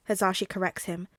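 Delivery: ordinary delivery, nothing marked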